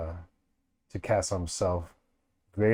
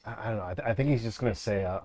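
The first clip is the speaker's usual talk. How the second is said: usual speaking voice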